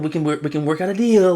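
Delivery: lightly voiced eager negotiator; a hint of a wheedling deal offer; mock dealmaker voice.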